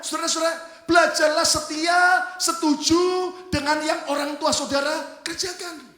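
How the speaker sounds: high, loud exhortation; full-force preaching. Real, not acted. Preacher urging the congregation to obey parents, full-force preaching. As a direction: proclaim it to the whole congregation.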